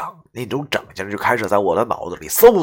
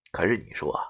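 usual speaking voice

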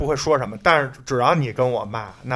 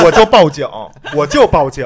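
laughing loud joke; 10 dB louder than usual. Real, not acted. Joking plan, laughing loudly.

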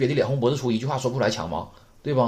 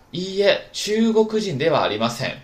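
model reading; 7 semitones higher, 5 dB louder than usual. Shown as a demonstration. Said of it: "我们把这例子再读一下".

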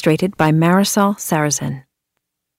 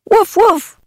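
high playful woofs; puppy barking; 12 semitones higher than usual. Acted. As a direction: bark like a happy puppy. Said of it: "said Sam".

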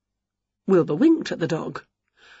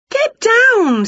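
high, louder cry of alarm; high yelp; 5 dB louder than usual. A narrator's reading. Witch pushing a muddy cog off, high and louder. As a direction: push the dog off in a panic. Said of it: "said Winnie".

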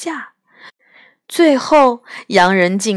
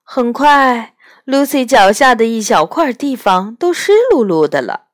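ordinary delivery, nothing marked

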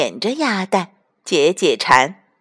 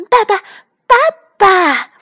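very high, loud duckling cry; calling duckling; 13 semitones higher, 8 dB louder than usual. A narrator's reading. Duckling on the fox's head, crying out for papa. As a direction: squeal for papa at full voice.

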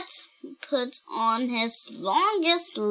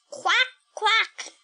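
duck quack; 10 semitones higher than usual. Acted. George quacks at vet.

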